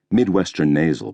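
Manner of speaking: ordinary delivery, nothing marked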